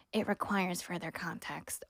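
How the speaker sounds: slow, soft, mocking imitation of a dismissive official; mocking dismissive quote. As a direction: drag it out slowly and quietly, mocking her evasion. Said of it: "you could imagine my surprise when she goes".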